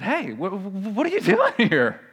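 high, stammering surprised greeting; excited recognition. Acted. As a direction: act delighted to spot a fellow Southerner, pitch leaping up with a laugh. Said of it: "they heard a, a good Southern boy like them".

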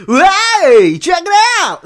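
very high, loud cartoon character shout; zany high-pitched voice demo; cartoon voiceover. Shown as a demonstration. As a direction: holler like a wacky cartoon sidekick. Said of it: "Yeah, we can do voice".

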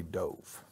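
"dove" with a long o, rhyming with "stove"; Crown Heights dove. Shown as a demonstration. Teacher shows how Crown Heights says dove, quietly. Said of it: "Crown Heights, they say".

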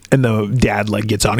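usual speaking voice

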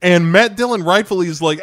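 ordinary talk

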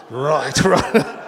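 loud laughing mock reluctance; teasing a bearded man in the crowd, laughing; laughing imitation. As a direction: laugh while aping his hesitant right.

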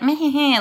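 short haughty mocking chuckle; snooty laugh. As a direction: scoff like a snob. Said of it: "like, snooty".

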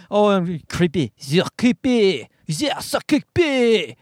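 slow, drawn-out mocking of the woman; mocking woman's disdain. Comic mimics the rude woman calling him creepy, slow sneer. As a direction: sneer it slowly as if she judges you.

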